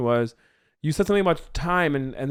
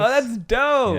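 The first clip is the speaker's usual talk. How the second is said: higher and louder praise; excited; 10 semitones higher, 5 dB louder, much slower than usual. Real, not acted. Writer hyping the pitch, higher and louder. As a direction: cheer the idea on.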